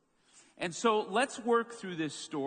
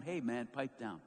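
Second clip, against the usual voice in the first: low muttered hush; 5 semitones lower, 7 dB quieter than usual. Retold or imitated. Crowd hushing the beggar.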